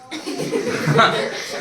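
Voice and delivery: short breathy chuckle; amused little laugh. Real, not acted. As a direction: chuckle at your own joke. Chuckles at own joke about cursing.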